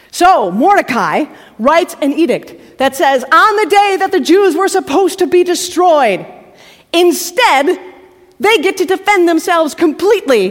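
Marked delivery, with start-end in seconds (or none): 3.2-10.5 higher, louder, rousing; rousing announcement of the reversal; triumphant. A narrator's reading. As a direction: proclaim the victory.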